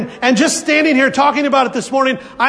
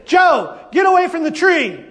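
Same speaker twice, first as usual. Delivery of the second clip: mom calling out; 6 semitones higher than usual. Retold or imitated. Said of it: "she'd just have to say".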